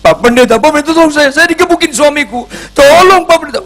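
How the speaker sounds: high, loud frantic cry for help; a beaten wife screaming down the phone; wife calling the pastor in a high, frantic, loud voice; panicked wife. Retold or imitated. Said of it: "istrinya telepon saya".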